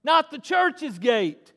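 loud, high, slow proclamation; impassioned pulpit shout; full-force preaching. Real, not acted. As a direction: drive each phrase home to the congregation.

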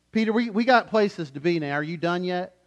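mock-impatient whisper-free aside, pushy and loud; playful impatient churchgoer. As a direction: hurry the preacher along, teasing. Impatient listeners in the sermon.

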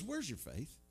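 imitating mild irritation; a little irritated. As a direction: quote it as if slightly fed up. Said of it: "maybe he got a little irritated".

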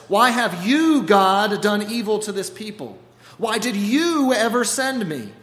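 loud, high, anguished protest; crying out to God. Acted. Moses cries out at God in angry confusion. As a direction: accuse God, voice straining up. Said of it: "crying out in confusion".

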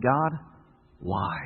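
low, hushed plea to God; subdued questioning. Retold or imitated. Weary believer asks God about life's hardships, subdued and low. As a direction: let it drop low and soft.

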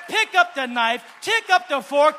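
loud preacher shout on "Pick up the knife"; shouted sermon command. Real, not acted. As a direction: bellow it to the congregation. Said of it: "same command shouted".

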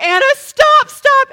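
loud, high repeated command; panicked shouting. Retold or imitated. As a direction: shout frantically at the driver. Retold panicked orders to driver.

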